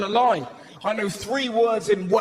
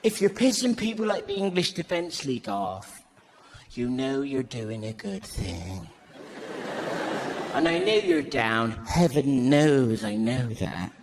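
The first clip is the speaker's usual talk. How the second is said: lovely mum; 5 semitones lower than usual. Retold or imitated. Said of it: "My mum's was the loveliest".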